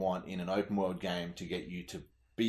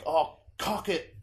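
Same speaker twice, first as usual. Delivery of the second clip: put-on higher, slow old Cockney lady voice; old Cockney woman; 9 semitones higher, 4 dB louder, slower than usual. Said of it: "the fucking woman, she's like".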